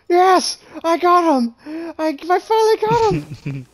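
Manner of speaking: high-pitched victorious exclaiming; excited triumph. Real, not acted. Player who finally got the opponent, high triumphant cheer. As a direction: celebrate a long-awaited hit.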